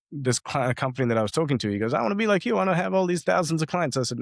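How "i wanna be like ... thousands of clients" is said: much higher, gushing mock-eager voice; eager mentee mocked. Mentor mocks the mentee wanting thousands of clients, high and gushing. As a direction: play him up as a starry-eyed kid who wants it all.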